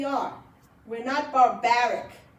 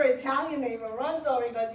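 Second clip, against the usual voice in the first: ordinary talk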